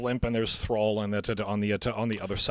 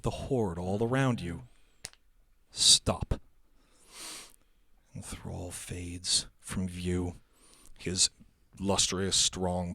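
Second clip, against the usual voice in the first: hushed, slow reveal with long pauses; game master narration; 5 semitones lower, 5 dB quieter, slower than usual. Acted. Game master describing Thrall fading, slow ominous narration. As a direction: unfold it gradually, phrase by phrase.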